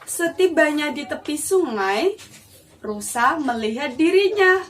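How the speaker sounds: ordinary delivery, nothing marked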